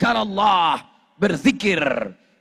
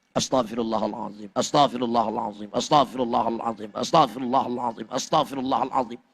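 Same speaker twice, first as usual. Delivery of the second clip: low, soft, quick monotone dhikr; repeated prayer murmur; 9 semitones lower, 4 dB quieter, faster than usual. Shown as a demonstration. Preacher demonstrating repeated istighfar, low quick murmur. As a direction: repeat it quietly and rapidly, far down in pitch.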